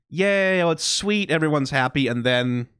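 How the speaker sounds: ordinary delivery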